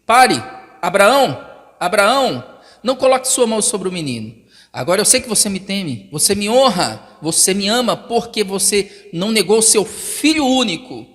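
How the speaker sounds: loud urgent call; angel's cry to Abraham; God calling stop. Acted. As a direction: halt him mid-stroke.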